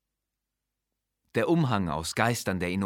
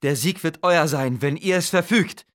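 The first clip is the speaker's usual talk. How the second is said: much higher, louder, fawning vizier protesting loyalty; servile vizier; 8 semitones higher, 7 dB louder than usual. Acted. Vizier swears loyalty to the living god, higher and louder. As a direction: heap the praise on like a frightened courtier.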